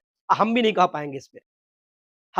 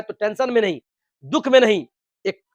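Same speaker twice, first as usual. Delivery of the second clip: usual speaking voice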